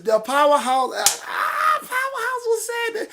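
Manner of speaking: drawn-out cry breaking into high excitement; whooping it up over a favorite; exultant outburst. Real, not acted. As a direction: shoot up into a high, thrilled squeal. Exults over a listener's comment.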